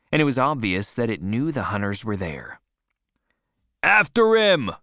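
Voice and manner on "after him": high, loud shouted command; cried out. A narrator's reading. Boat leader starting the whale chase, crying out. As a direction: yell to set the chase off. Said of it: "cried Stubb".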